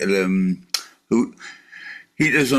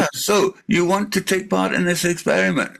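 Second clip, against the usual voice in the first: higher, scripted canned sales line; mock robot caller; 7 semitones higher than usual. Man mimicking a robot call, higher mock scripted pitch. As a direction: recite like a chirpy sales bot.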